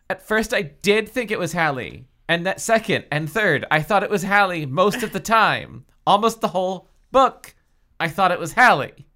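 high, loud, insistent confession; hammed-up, escalating admission; emphatic admission. A narrator's reading. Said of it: "Brenda admitted".

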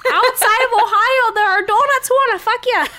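high, loud, hammed-up warning; Midwest preacher voice. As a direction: warn them like a fire-and-brimstone local. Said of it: "They're probably like".

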